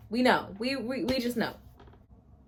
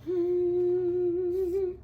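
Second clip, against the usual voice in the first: long, high whine of a hum; high drawn-out hum; 10 semitones higher than usual. Real, not acted. Reader taking in the pet name, long high hum.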